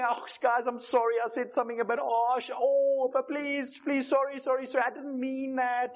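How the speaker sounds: a lightly voiced, fawning backpedal; pleading apology. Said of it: "we're going".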